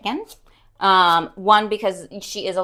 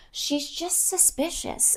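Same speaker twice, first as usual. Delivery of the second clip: drawn out; 8 semitones higher, 9 dB quieter, slower than usual. Real, not acted. Teasing about dog.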